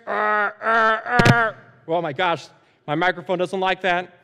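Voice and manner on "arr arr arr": loud, higher dinosaur roars; pretend T-Rex roar. Dad pretending to be a T-Rex at bedtime, roaring. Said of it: "pretending to be a T-Rex".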